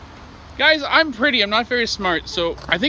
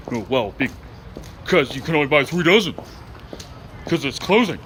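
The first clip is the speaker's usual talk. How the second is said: mock grumpy voice, pitched low; grumpy bakery lady; 7 semitones lower than usual. Bakery lady refusing joking customers more cookies, imitated grumpily. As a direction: imitate a humorless shop worker, low. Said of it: "They're pretty grumpy".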